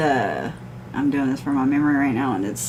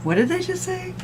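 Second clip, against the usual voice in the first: baffled; 12 semitones higher than usual. Retold or imitated. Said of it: "I'm like".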